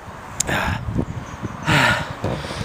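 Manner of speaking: long, soft puffing out of breath; winded heavy breathing. Real, not acted. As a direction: catch your breath audibly. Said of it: "I'm out of breath now".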